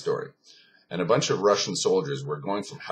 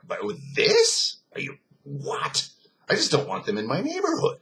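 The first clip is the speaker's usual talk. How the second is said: high, stammering disbelief; appalled shock; 9 semitones higher, 3 dB louder than usual. Retold or imitated. Anti-Semitic soldier seeing a concentration camp, appalled and stammering. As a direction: voice leaps up, breaking off and restarting.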